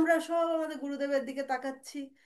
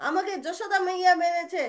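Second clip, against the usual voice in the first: put-on child's complaint; 6 semitones higher, 6 dB louder than usual. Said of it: "বলরামকে তখন কানহা বলছে".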